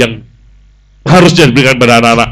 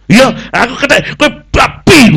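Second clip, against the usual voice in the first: much higher, a petty comeback; mock squabbling retort; 12 semitones higher than usual. Two people trading insults, high and mocking. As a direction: toss back the insult childishly. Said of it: "Ngono bales-balesan".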